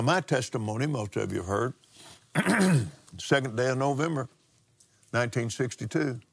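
ordinary delivery

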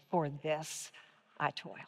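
quiet, firm declaration; confident declaration. Shown as a demonstration. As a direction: state it with calm certainty. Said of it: "a very confident declaration".

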